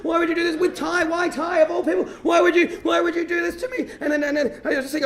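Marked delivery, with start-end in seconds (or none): 0.0-3.9 high, pleading heartbroken whine; hammed-up wailing, questions tumbling out; mock crying wail. Boy running home heartbroken over Emily, mock crying wail. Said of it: "run all the way home crying, going".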